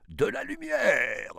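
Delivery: high, loud declaimed cry; dramatic cry. A narrator's reading. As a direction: cry out theatrically, pitch soaring. (cri déclamé, aigu et fort)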